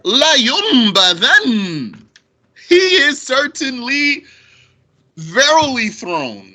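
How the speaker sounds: La yunbadhan said slow and loud, certainly pitched high; emphatic stress. Shown as a demonstration. Teacher stressing the double emphasis for students. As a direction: drive home every emphasizer. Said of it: "two emphasizers".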